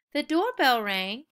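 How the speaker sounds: usual speaking voice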